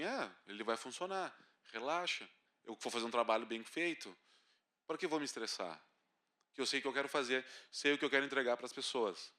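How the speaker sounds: soft, low, unhurried reassurance; calm self-talk. Retold or imitated. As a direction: talk yourself down gently. Calm self-talk at the broken projector.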